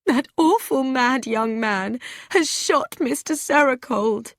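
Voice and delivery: upset woman close to tears; lightly voiced tearful report; tearfully. A narrator's reading. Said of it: "Mildred said tearfully".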